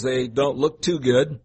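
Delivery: ordinary delivery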